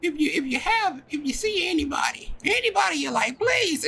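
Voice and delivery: very high, insistent motherly rant; mother going off. Acted. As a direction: nag and plead in a squeaky high voice. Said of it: "going off now".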